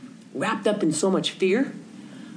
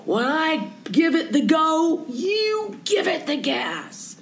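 much higher, slow, drawn-out call; yelling instructions; 10 semitones higher, slower than usual. Retold or imitated. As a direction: call out each word slowly to the old man. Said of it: "Martin yells at him".

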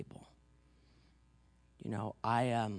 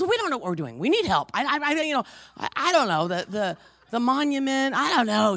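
high, loud, stammering mock panic; flustered; 10 semitones higher, 8 dB louder than usual. Retelling a wife's helpless vacation planning, flustered and loud. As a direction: act out an overwhelmed wife stumbling over her words. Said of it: "She was so flustered".

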